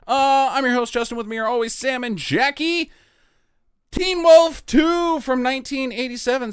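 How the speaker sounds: high voice rising at every phrase end; voice goes up at ends. Pretend. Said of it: "the podcast where my voice goes up at the end of every sentence or at commas".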